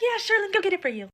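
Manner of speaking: high, sweet compliant waitress; mock waitress voice. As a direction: play the waitress being nice to the man. Waitress agreeing to men.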